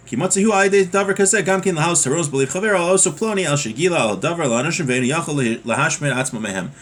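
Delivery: usual speaking voice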